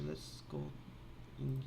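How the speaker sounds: ordinary talk, nothing marked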